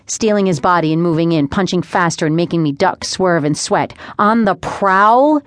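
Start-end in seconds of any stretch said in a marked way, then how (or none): 4.2-5.4 slow, higher, rising disbelief; indignant echo. A narrator's reading. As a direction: throw it back as an offended question. Sister indignant at brother's dig.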